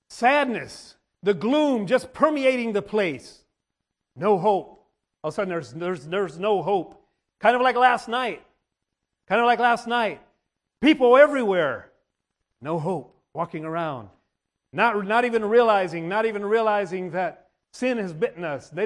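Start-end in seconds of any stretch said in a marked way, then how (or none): none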